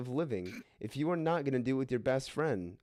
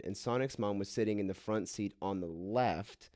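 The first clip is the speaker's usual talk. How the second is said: ordinary talk, nothing marked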